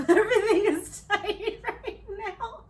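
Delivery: laughing high and loud, slow. Real, not acted. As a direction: laugh through the words. Laughs through sweating joke.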